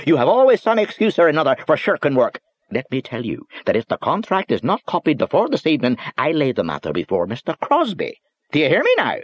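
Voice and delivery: high, loud scolding Northern Irish voice; angry little boss. Acted. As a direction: tear into him from behind the desk. Boss berating clerk.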